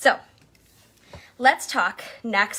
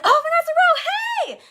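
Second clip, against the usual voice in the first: much higher, louder sing-song fan greeting; mock gushing greeting; 6 dB louder than usual. Team members greeting their leader, gushing. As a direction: squeal it like a starstruck fan.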